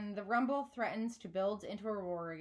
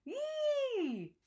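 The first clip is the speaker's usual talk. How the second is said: squeal. A narrator's reading. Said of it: "hopping on the linoleum".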